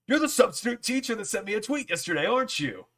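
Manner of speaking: high-pitched, imitating a delighted star; light up on recognizing someone; excited recognition. Star recognizes him in story.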